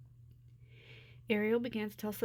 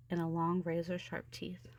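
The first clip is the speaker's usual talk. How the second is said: ordinary delivery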